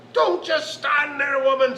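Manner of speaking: loud, high, slow roar; bad-tempered leprechaun shout; tiny man's roar. A narrator's reading. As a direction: roar it like a furious little man. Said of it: "as a tiny little man could roar".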